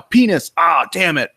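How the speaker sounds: sudden high, loud blurt; blurted answer. Retold or imitated. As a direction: blurt it out, then catch yourself. Blurting answer in thinking game.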